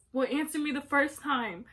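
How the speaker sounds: mock-angry mom telling off; mad mom. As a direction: snap it like an annoyed mother. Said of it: "she gets mad at me".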